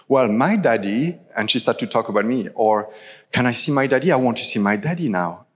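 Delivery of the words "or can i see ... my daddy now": ordinary talk, nothing marked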